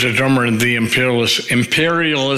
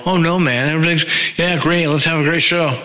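fake cheerful; 4 semitones higher than usual. Bandmates fake-cheerful in dressing room.

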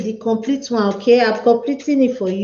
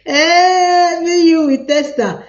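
hammed-up wail, higher and louder; mock crying; 6 semitones higher, 4 dB louder, slower than usual. Host mock-wails to her stream viewers, hammed-up crying. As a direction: fake a playful cry, pitched up.

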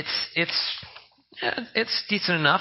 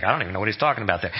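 rushed, lower mock listener; mock confused listener; 8 semitones lower, much faster than usual. Pastor voicing a confused listener, quick and lower. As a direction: rattle it off fast like a lost churchgoer. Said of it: "none of you guys are like".

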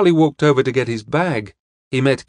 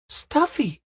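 put-on high-pitched questioning "Stuffy?" in a girl's voice; surprised cry; 4 dB quieter than usual. A narrator's reading. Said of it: "cried Pamela Potter".

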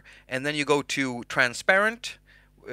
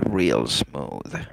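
much lower, a crooning real smooth; deep smooth voice; 13 semitones lower than usual. Acted.

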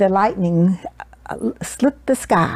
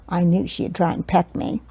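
ordinary delivery

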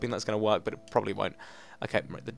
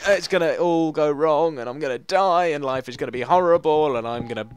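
mock despair; 4 semitones higher, 6 dB louder than usual. Mock doom about failing.

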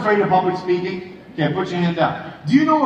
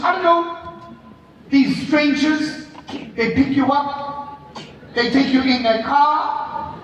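higher voice in halting, separate phrases; suspicious mother; 5 semitones higher than usual. Retold or imitated. Mom questioning her son's outings, imitated suspicion.

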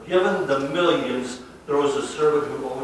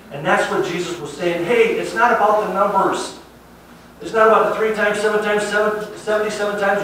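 loud, higher preaching that drives the point home; preaching at full force; 5 semitones higher, 8 dB louder than usual. Acted. Pastor urging the congregation to stop counting, preaching loudly. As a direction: preach it to the back row.

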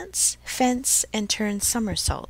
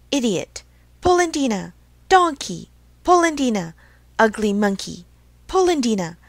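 trading insults; 2 semitones higher, 6 dB louder than usual. Acted. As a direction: fling each insult as a separate jab. Acted exchange of insults.

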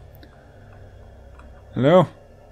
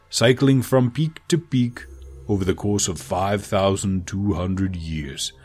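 put-on slow, low, savouring each word in a German accent; a German dragon envoy tasting every vowel; German accent, taking his time; 4 semitones lower, slower than usual. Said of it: "speaks lowly with, with a deep, melodious German accent".

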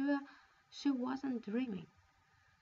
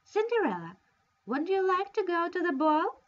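higher, louder, sing-song mock question; mock sweet taunt; 8 semitones higher, 10 dB louder than usual. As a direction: dangle the ball in front of her cruelly. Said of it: "Charlotta teased her".

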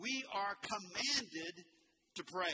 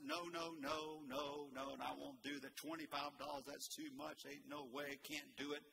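imitating a stingy farmer; 5 semitones lower, 6 dB quieter than usual. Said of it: "The farmer said".

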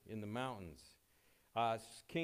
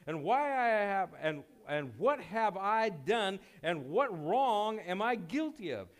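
preaching at full force; 5 semitones higher, 7 dB louder than usual. Acted. Preacher reading David's plea to the king, loud and impassioned.

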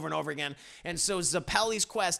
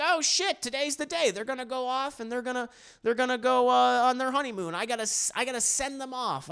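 higher-pitched, acted sudden realisation; realising Speedwagon; 7 semitones higher than usual. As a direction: voice a friend remembering the big send-off. Speedwagon realises friends leave today.

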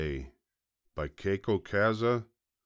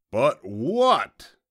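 loud, raised, impatient fox growl; 6 semitones higher, 9 dB louder than usual. A narrator's reading. Fox losing patience with the piglet, growling loudly. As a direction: growl it back loudly, cutting in. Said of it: "growled the fox".

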